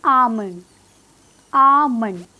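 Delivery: आमंड with a long open aa and no L; a loud, isolated आमंड, the D barely sounded; correct almond pronunciation. Shown as a demonstration. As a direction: stretch the first vowel of आमंड, swallow the final D. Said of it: "इसकी correct pronunciation क्या है".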